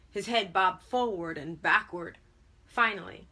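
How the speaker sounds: usual speaking voice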